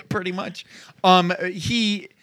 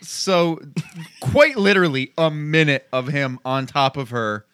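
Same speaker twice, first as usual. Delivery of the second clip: normal talking voice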